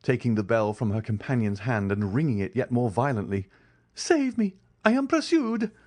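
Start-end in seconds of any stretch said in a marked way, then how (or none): 3.9-5.7 high, begging cry; hunted man pleading, pitched high; desperate plea. A narrator's reading. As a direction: clasp hands and beg to be saved. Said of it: "said Andrea, clasping his hands".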